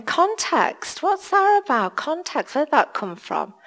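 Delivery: high, soft, baffled self-questioning; puzzled musing. Retold or imitated. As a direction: puzzle over the word. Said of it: "And I was saying".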